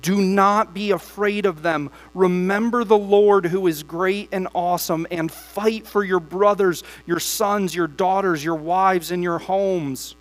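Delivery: steady, measured Bible reading to the church; congregation-facing scripture reading. Acted. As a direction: read the passage aloud with weight. Reads long Nehemiah passages.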